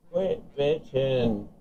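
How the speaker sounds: slow, stretched repeat of Quit bitching; drawn-out taunt. Real, not acted. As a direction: drag out Quit bitching slowly to mock him.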